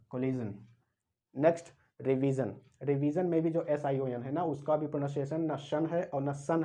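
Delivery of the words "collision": कोलिजन ending in a voiced "jun". Shown as a demonstration. Teacher repeats for students, कोलिजन with a "jun" ending. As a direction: say कोलिजन with j, not sh. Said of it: "वो जा और ना से होगा".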